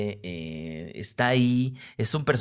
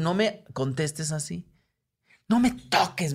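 mild mock scolding; 7 semitones higher than usual. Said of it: "me dijo".

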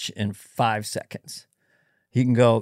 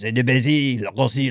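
mock French accent; 2 semitones lower than usual. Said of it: "like French is".

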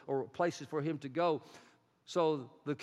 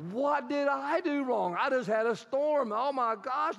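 distraught pig herder; 9 semitones higher, 4 dB louder than usual. Acted. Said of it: "the pig herder goes".

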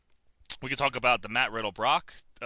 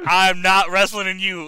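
mock Brock; 6 semitones higher, 8 dB louder, slower than usual. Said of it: "Brock walked up to him and said".

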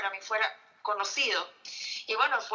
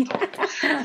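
laughing; 6 semitones higher, 5 dB louder than usual. Real, not acted. Risa tras el premio Cervantes.

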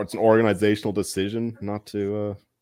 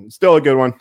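loud, emphatic pick-me-up on still; loud insistence; 5 semitones higher, 11 dB louder than usual. Real, not acted. Bettor to himself, loud insistence. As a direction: bark it out to reassure himself. Insisting the day was good.

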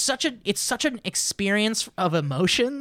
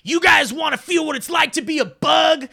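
villain bug shout; 6 semitones higher, 5 dB louder than usual. Retold or imitated. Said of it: "and he's like".

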